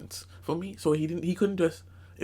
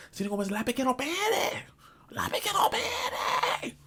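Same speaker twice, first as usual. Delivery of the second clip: mock scream. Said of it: "I would, I would scream it".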